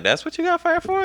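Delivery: very high-pitched incredulous question; retold disbelief. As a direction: imitate someone astonished, voice shooting way up. Acted disbelief of new district.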